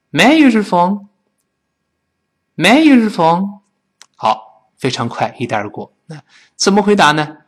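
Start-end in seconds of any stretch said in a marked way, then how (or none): 0.1-3.6 quick, words run together; quick linked "May I use your phone"; faster. Shown as a demonstration. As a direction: rush through it casually. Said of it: "再快点".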